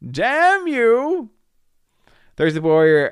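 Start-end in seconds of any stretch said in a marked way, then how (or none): 0.1-1.3 high yelled mock curse; hammed-up cry of outrage; mock shout. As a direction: throw up your hands and yell it.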